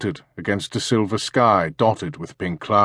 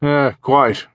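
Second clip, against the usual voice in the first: throaty posh English major, raised and loud; upper-class English; 7 semitones higher, 5 dB louder than usual. Acted. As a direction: play a pompous retired English officer. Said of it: "in a throaty, upper-class English voice".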